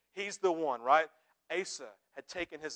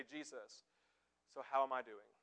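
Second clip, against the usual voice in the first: hushed prayer; 6 semitones lower, 12 dB quieter than usual. Acted. Pastor voicing a quiet prayer.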